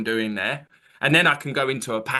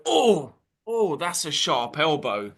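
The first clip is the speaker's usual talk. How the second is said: mock ouch; 2 semitones higher, slower than usual. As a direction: act playfully jabbed by an elbow. Acting out mock hurt opener.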